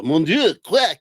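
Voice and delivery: hammy French quack, pitch rising; French chef accent. Pretend. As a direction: play a cartoon French chef. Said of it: "Now say in the accent, [name]".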